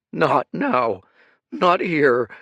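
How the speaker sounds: high, loud, shattered cry; voice cracking. Acted. Grieving Kuruk at his father's tomb, voice breaking. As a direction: let the voice crack on each word. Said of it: "voice was shattered ... cracking".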